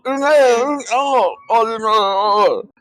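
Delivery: a hungover goblin moaning through a headache; goblin groaning. Acted. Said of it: "You can tell he looks like he's got a headache".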